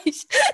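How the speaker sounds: high squealing laugh burst. Real, not acted. Laughing fit.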